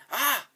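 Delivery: loud, high "ah!"; sudden yelp cutting off the sentence; startled yelp. Acted. Game character startled mid-sentence.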